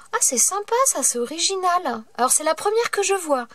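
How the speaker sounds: light up at a surprise gift; delighted. Real, not acted. Discovering a painted key. (enthousiasme aigu, ravie)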